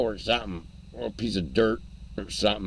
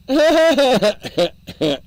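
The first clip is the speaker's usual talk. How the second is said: loud laugh; 12 semitones higher, 8 dB louder than usual. Real, not acted. Laughs at own joke.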